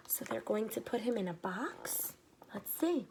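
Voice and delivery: hushed whispered aside. Real, not acted. Aside to the children, whispered. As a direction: lean in and whisper the question.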